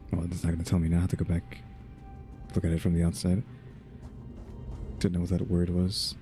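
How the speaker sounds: ordinary delivery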